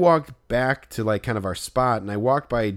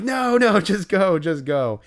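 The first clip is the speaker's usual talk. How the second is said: mock plea to go, trailing off; urgent no, fading; 7 semitones higher, slower than usual. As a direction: urge him off, easing down. Urging player to drive off.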